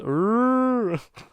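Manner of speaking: loud high laugh. Real, not acted. Laughing at the quote.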